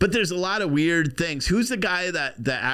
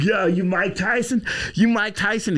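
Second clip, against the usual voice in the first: higher, pestering imitation of a taunting passenger; mock heckler; 8 semitones higher than usual. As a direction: badger him with a put-on voice. Plays man taunting Tyson on plane.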